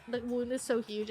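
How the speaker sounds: usual speaking voice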